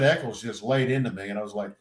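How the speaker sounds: ordinary delivery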